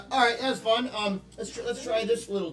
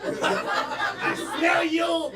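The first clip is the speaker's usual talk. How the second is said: much higher posh British lady voice; mock British accent; 4 semitones higher than usual. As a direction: play the mother-in-law in a hammed-up British accent. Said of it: "British, French, and German".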